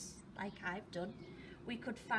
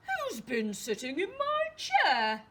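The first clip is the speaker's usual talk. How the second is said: booming, indignant Father Bear; loud storybook bear shout, pitch raised; 5 semitones higher, 9 dB louder than usual. Acted. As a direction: bellow it like a big bear. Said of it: "in a very loud voice".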